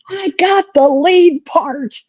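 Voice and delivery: high, slow and proud; excited kid. Retold or imitated. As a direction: savor the big news like a thrilled boy. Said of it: "was so excited".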